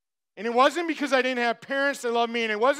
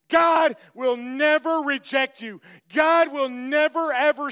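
loud impassioned cry; fervent preaching shout; 3 semitones higher, 4 dB louder than usual. Real, not acted. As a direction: shout it out with conviction. Preacher insisting at full force.

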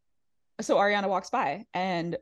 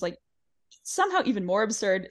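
ordinary talk, nothing marked

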